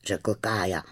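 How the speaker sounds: plain reading voice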